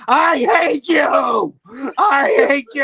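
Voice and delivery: anguished, drawn-out furious scream; Baroni. Retold or imitated. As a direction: scream it slow and very loud, mock-melodramatic. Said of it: "Baroni going".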